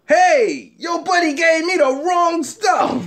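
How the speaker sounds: high-pitched mock outrage; retold angry complaint. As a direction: imitate an indignant caller, high and loud. Acted complaint on phone call.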